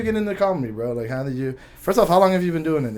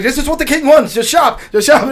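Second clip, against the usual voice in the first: hammed-up ordering king, higher voice; mock commander; 8 semitones higher than usual. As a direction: bark the order like a pompous commander.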